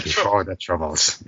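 light Irish accent. Shown as a demonstration.

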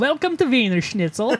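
hammed-up German greeting at the counter; mock German accent. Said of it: "Did they talk like that? Was it just Germans?".

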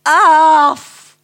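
sharp, high and very loud "Af!"; high shrill command. Acted. Lady orders her dogs down, high and shrill. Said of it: "Haar stem was hoog en schel geworden".

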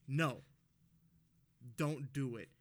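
loud sharp no; mock stern warning. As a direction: bark it like an annoyed homeowner. Customer warning driver off driveway.

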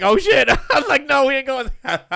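high, loud, laughing denial; laughing panic. Real, not acted. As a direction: laugh while dodging the truth. Caught out, laughing.

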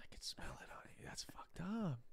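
whispered suspicious onlooker; hushed, breath-only mock accusation. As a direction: whisper it as if secretly judging someone. Mocking people who'd sniff her out.

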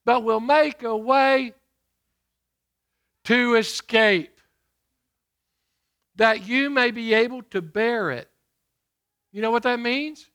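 loud, ringing pulpit delivery; booming sermon voice; preaching at full force. Acted. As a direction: preach it out to the congregation. Preached scripture reading.

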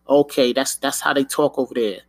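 ordinary talk

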